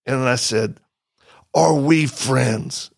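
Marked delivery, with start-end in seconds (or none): 1.5-2.9 very slow, each word spaced out; slow deliberate question. Retold or imitated. Asking the agitated soldier slowly whether they are friends. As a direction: ask it slowly and carefully, letting every word land. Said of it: "we're yelling at this point".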